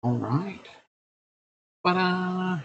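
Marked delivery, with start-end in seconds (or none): 1.8-2.6 high sing-song reveal; playful ta-da. Real, not acted. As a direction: present it like a magic trick. Announcing his wife's return.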